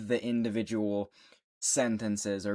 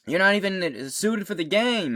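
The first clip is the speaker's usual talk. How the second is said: teammate taunt; 8 semitones higher, 4 dB louder than usual. Retold or imitated. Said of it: "his teammate goes".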